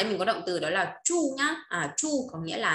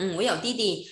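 usual speaking voice

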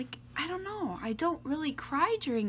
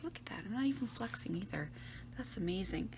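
whispering; 9 dB quieter, faster than usual. Real, not acted. Admiring collarbones to camera.

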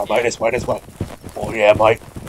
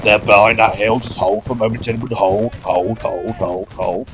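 lightly voiced Australian bloke rallying his mates; easygoing Australian accent, mate-heavy; a touch of Aussie accent for a cheeky squad leader; Australian officer. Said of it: "it's Australian Ted".

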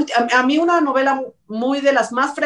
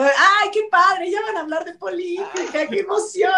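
sarcastic excitement; 9 semitones higher than usual. Pretend. Host mocking the audience's love of politics, sarcastic gushing. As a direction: gush with fake enthusiasm over politics talk.

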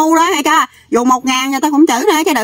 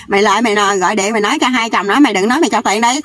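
ordinary talk, nothing marked

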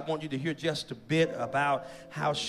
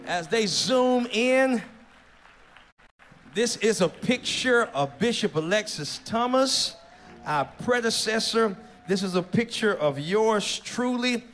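raised, ringing voice to the whole church; proud, loud presentation; 4 semitones higher, 5 dB louder than usual. Real, not acted. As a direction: present it grandly to the congregation. Pastor proudly presents the unveiled piece.

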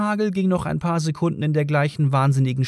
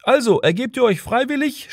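high-pitched called-out demand; shouting policeman; 11 semitones higher than usual. A narrator's reading. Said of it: "schrie wieder einer von den beiden Bullen".

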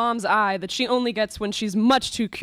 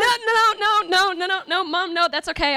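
high, rushed panicked refusal; flustered, backpedal fast; 8 semitones higher than usual. Acted.

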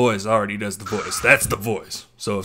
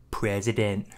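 lightly voiced Trump; Trump impression; 3 semitones lower, 8 dB quieter than usual. Streamer practising Trump catchwords for a friend, light Trump impression. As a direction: say it like Trump, low key. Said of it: "when you're doing the Trump voice".

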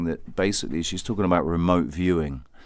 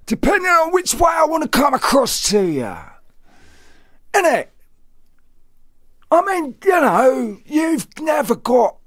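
intense mask voice. Acted. Showing off one of his masks, very high and shouted. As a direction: shout it very high like a manic character. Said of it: "different masks that I could adorn".

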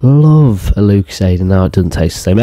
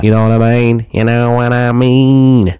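put-on voice. Put-on voice, repeated.

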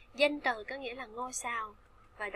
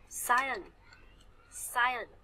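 "Science" hit on SCI, dropping after; stress on first syllable; 4 dB louder than usual. Shown as a demonstration. Teacher has students read along, "Science" stressed at the front. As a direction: punch the start of "Science". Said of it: "science chúng ta sẽ nhấn âm thứ nhất".

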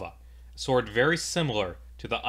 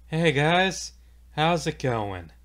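high, breezy, unbothered hello; casual villain greeting; 6 semitones higher, 4 dB louder, slower than usual. Acted. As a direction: greet them lightly over the body. Villain's casual greeting over a corpse.

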